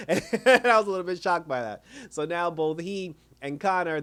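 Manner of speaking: normal talking voice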